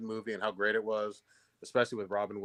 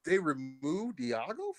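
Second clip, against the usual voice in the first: higher, drawn-out incredulous question; real disbelief; 5 semitones higher, slower than usual. Real, not acted. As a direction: stretch it out in surprised disbelief. Just learned the parrot was cut.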